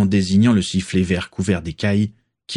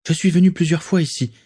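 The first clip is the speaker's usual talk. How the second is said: Daisy speaking; 9 semitones higher, faster than usual. A narrator's reading. Daisy voiced.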